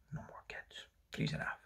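very soft whisper; whispered aside. Real, not acted. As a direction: whisper it under your breath. Muttered aside after brutal line.

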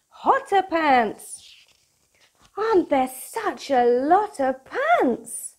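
bright, bouncy storybook read-aloud; lively picture book voice for kids; reading to children. Acted. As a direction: read it like bedtime story time for little ones.

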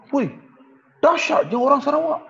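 high, astonished gasp of disbelief; British officials reacting in mock shock; shocked exclamation. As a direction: sound stunned by the mass resignation.

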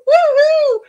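high, loud woohoo; big celebratory squeal; tearful cheer. Real, not acted. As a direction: whoop for joy through tears. Celebrating her picture in the magazine.

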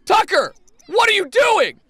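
high, loud, baffled yell; alarmed shouting. Real, not acted. Player startled by Tucker, alarmed shouting. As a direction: shout at a misbehaving pet.

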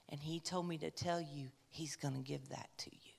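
a secret whispered close to the ear; voiceless, confiding whisper; hushed whisper. Retold or imitated. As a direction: lean in and breathe the message. Elderly man whispers God's message.

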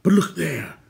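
high, loud exclamation; sudden cry. A narrator's reading. Gwydion spotting the Horned King, crying out. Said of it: "Gwydion cried suddenly".

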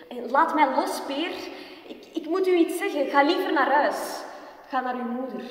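high, loud and fed up, telling him off; a young woman scolding Peer to go home, loud and high; exasperated scolding. Acted. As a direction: push him away, sharp and impatient.

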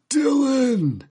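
high, drawn-out movie yell; Arnold. Acted. Host quoting a favorite Arnold line, high theatrical yell. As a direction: belt it out like an action hero's catchphrase. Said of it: "my favorite line from Arnold".

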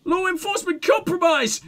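high screamed code; screaming. Acted. Woman screaming codes into her megaphone. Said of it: "she screams in the megaphone".

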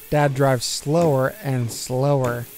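ordinary delivery, nothing marked

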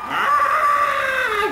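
very high mock shriek aaah; mock scream. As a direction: scream back at the ghost. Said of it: "Yo también sé gritar". (grito agudo de burla)

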